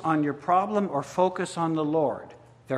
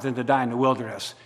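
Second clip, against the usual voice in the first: usual speaking voice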